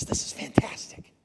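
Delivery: slow, whispered marvelling; hushed mock awe. Real, not acted. Quoted Paul, whispered aside.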